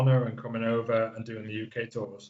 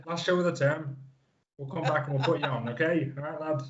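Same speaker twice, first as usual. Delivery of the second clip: slightly raised, reassuring promoter voice; Brian Dixon; 3 semitones higher than usual. Acted. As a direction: imitate a promoter promising the lad a spot. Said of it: "I've gotta hear the Brian Dixon impression".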